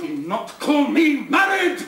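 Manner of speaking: high, slow, roaring denial; furious shout. Acted. Rochester denying he is married, furious shout. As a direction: roar the words at her.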